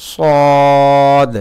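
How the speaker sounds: loud, full-mouthed demo of sad; Arabic letter sad. Shown as a demonstration. Teacher demonstrating the letter sad, loud and full-mouthed. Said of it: "সদরা উচ্চারণ করার সময়".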